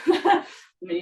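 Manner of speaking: laughing through the words; a real laugh breaking in as the joke lands. Real, not acted. Teacher laughing at her own joke about the big foot, laughing.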